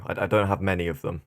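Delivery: ordinary talk, nothing marked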